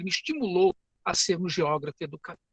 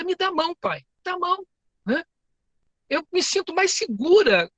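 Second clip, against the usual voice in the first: put-on high childlike plea for her father's hand; little daughter's voice; 10 semitones higher than usual. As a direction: play a small girl asking her dad for help. Filha pequena pede a mão. (voz de menininha pedindo a mão do pai)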